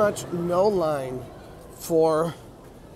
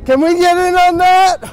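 higher, louder call across; 12 semitones higher, 11 dB louder than usual. Real, not acted. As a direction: call out to the staff. Calling to ride staff.

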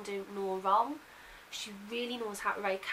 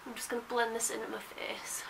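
whispering. Real, not acted. Quiet aside while applying makeup.

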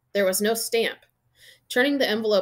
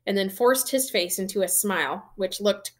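ordinary delivery

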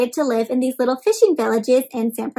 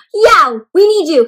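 a father calling his son sharply, louder; 5 semitones higher, 10 dB louder than usual. A narrator's reading. Father calls Yao to help the boat, sharply. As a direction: snap the name out to get him moving. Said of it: "his father said sharply".

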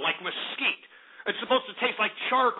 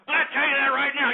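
fast and louder, driving it home; emphatic repeat; 5 semitones higher, 5 dB louder, faster than usual. Real, not acted. Repeating the boast to listeners, fast and loud. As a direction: hammer the promise home quickly.

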